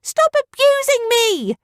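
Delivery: high silly villager yelling; yelled. Acted. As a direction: wail in mock outrage. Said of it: "yelled back".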